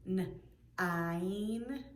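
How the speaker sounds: "n-ine" split after the first n, the vowel held; sounded out. Shown as a demonstration. Teacher quizzing children on the I sound, "n-ine" broken in two. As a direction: ask "n-ine" in two slow parts. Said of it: "Do you hear an I sound".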